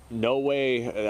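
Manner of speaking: normal talking voice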